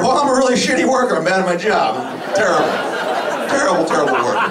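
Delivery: high, fast hammed-up admission; mock confession. Fired worker confessing to friends, high and fast. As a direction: rattle off the confession cheerfully.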